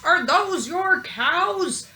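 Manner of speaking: high, slow sheriff voice; sheriff asking. A narrator's reading. Sheriff asking the farmer, high and slow. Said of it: "The sheriff asked".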